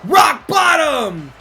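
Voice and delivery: loud, slow, stretched-out shout; shouted move call. Real, not acted. Gamer yelling the move name at the screen, big announcer shout. Calling wrestling moves while playing.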